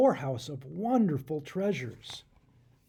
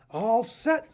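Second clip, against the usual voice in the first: higher, lively little bird call; a touch of eager sparrow; 6 semitones higher, 5 dB louder than usual. Acted. Sparrow telling Adam the glider is ready, eager.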